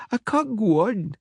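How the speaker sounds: put-on a tired child whimpering; snivelling girl. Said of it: "she snivelled".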